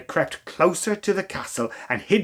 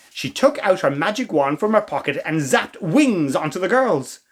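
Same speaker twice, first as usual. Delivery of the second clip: ordinary delivery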